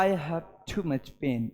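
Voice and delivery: low, soft, slow voice of someone hurting; pained. Shown as a demonstration. Teacher showing students a pained way of saying pain. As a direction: groan it out slowly as if in real pain. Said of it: "कहने का तरीके से आपको पता चल जाता".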